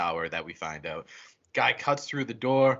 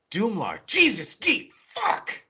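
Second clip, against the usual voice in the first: high, exasperated imitation of someone losing it; hammed-up exasperated cursing; mock angry outburst; 10 semitones higher, 5 dB louder, slower than usual. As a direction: act out getting mad, pitch climbing sharply. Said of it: "he gets mad at him".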